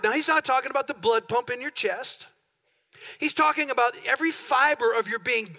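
ordinary talk, nothing marked

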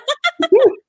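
high embarrassed giggle at his own accent; real laugh, high and bright. Real, not acted. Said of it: "I can't do accents".

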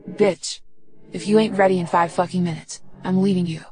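ordinary delivery, nothing marked

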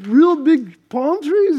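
high, loud, slow incredulous question; amazed, drawn-out disbelief; stunned disbelief. Retold or imitated. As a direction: gasp it out like you can't believe your ears. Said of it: "I'm like".

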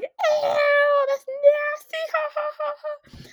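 very high, loud squealed taunt with fake laughter; imitating jeering classmates. Whole class mocking the farter, high jeering imitation. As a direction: mimic a class shrieking in mock disgust. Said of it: "everybody died laughing, they're like".

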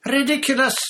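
very high, loud cry. A narrator's reading. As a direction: cry the spell out shrilly. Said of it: "cried Parvati".

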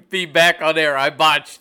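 laughing through the words; loud, laughing correction. Real, not acted. Laughs after botching a word.

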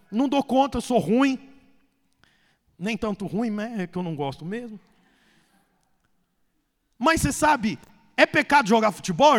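normal talking voice

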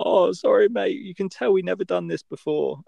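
high, apologetic dad caught out; embarrassed holidaymaker apologizing; sheepish tourist. Retold or imitated. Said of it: "and he's like".

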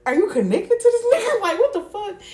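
high, loud incredulous squeal; shocked disbelief. Retold or imitated. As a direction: shriek it in disbelief. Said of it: "It was just like".

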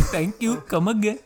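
put-on high-pitched Indian accent, copied in fun; playful Indian-accented farewell; Indian shopkeeper accent. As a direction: echo a cartoonish Indian shopkeeper. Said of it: "the Indian guy in G T A five".

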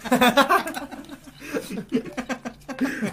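high burst of laughter; loud laugh. Real, not acted. Laughing at joke.

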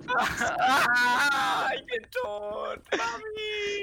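high wailing cry for mommy, stretched out; overacted dying scream; hammed-up death cry. Friend pretending to die on the plane, hammed-up wailing cry.

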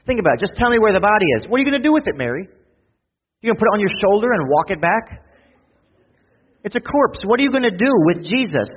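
loud, high, driving sermon delivery; fiery pulpit climax, pushing the congregation; preaching at full force. Acted. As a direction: preach it hard and build the urgency.

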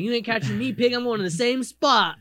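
playful impression of a speeder taunting a pig; cocky taunt. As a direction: taunt the cops like a smug speeder. Mocking fast drivers taunting police.

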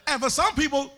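loud, sing-song preaching cadence; full-force preaching. Acted.

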